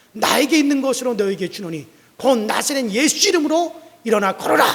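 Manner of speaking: a preacher's booming healing command; commanding shout. Acted. Peter commanding the lame man, loud and ringing.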